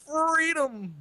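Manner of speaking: high shouted catchphrase; Anthony impression. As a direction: belt it out like Anthony. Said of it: "can you do an impression of Anthony".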